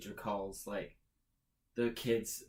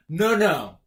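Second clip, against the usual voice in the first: loud scolding no; 3 semitones higher, 11 dB louder than usual. Retold or imitated. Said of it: "he's, like".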